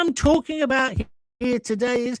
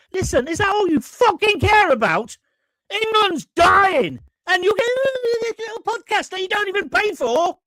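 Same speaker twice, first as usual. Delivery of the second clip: raised, exasperated rant at a listener; ratty outburst; 8 semitones higher than usual. Real, not acted. Host loses patience with a listener over audio, angry. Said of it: "I just got ratty a moment ago".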